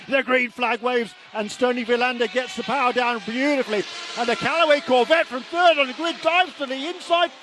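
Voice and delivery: high, loud race-start call; building thrill as the pack dives in; excited motorsport commentator. Real, not acted. Race start erupts live.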